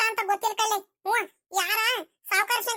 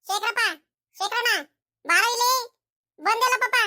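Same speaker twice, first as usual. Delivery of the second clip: calling out; 5 semitones higher than usual. Acted. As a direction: summon him from a distance, raised voice. Calling to the son from afar.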